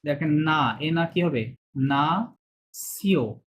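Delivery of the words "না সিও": না সিও split into syllables, very slow. Shown as a demonstration. Teacher breaking nationalité into parts for students, very slow. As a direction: break না সিও apart slowly. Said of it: "ছোট ছোট করে ভেঙে ভেঙে".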